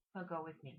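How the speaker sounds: usual speaking voice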